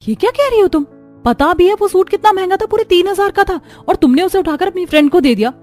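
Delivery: high, loud scolding of a friend; can't believe she gave it away, voice jumps up; indignant outrage. Acted. Upset about given-away suit. (नाराज़गी भरी ऊँची आवाज़)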